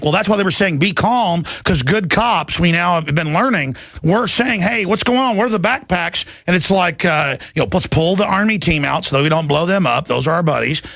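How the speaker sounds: ordinary talk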